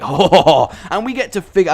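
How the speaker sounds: loud, excited mock French accent. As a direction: gush in a hammed-up French accent. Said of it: "I don't know why I went French".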